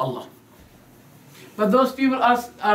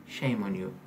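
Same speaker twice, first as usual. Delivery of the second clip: much lower and softer, a disapproving tut; mock scolding; 11 semitones lower, 11 dB quieter than usual. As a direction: drop low and quiet as if shaming.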